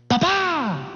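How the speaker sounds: high, drawn-out mock yell for dad; yelling child. Younger son calling dad at home, yelling. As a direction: imitate a confident boy calling through the house. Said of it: "me grita en la casa".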